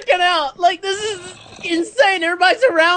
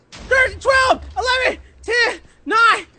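high-pitched, breathless-with-nerves counting; frantic countdown; 6 semitones higher than usual. Real, not acted. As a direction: count down desperately as time runs out. Hider counting down in panic.

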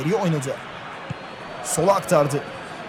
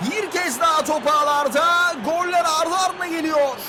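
excited commentator; 8 semitones higher than usual. Real, not acted. Celebrating the second goal.

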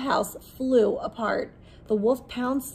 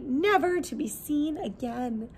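usual speaking voice

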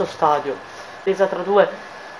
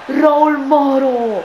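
long drawn-out excited call; breathless italian goal commentary; 7 semitones higher, 4 dB louder, much slower than usual. Real, not acted. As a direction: stretch the name out, thrilled. Goal chance, elongated call.

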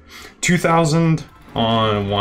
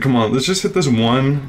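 normal talking voice

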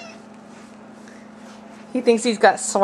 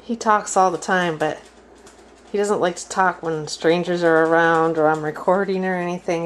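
ordinary talk, nothing marked

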